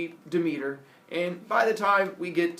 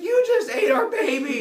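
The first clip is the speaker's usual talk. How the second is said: high, loud accusing cry; mock outrage; 13 semitones higher, 9 dB louder than usual. Rhea accusing Kronos of eating their baby, mock-outraged yell. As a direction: yell it as a hammed-up furious wife.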